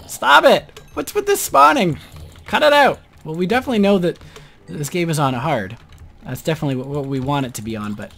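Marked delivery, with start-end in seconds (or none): 0.1-3.0 high exasperated yell; frustrated shouting. Real, not acted. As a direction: yell at the endless zombies. Zombies keep spawning.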